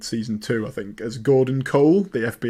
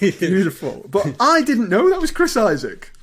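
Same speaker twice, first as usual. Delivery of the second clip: amused; 9 semitones higher, 3 dB louder than usual. Real, not acted. Amused delight at the singing.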